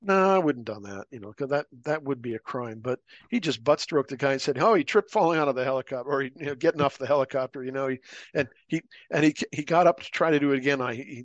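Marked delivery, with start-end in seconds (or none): 4.6-11.2 quick, mock-innocent alibi; sarcastic fast excuse. Narrator mocking Dale's fake excuse, fast and sly. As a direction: rattle off the cover story.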